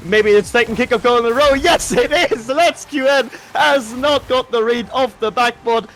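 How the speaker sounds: thrilled play-by-play burst; excited goal call. Real, not acted. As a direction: call it out as it goes in. Second kickoff goal called.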